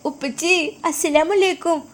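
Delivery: put-on high, childlike voice of a small daughter; little girl voice. Daughter calling her father on the phone, high childlike voice.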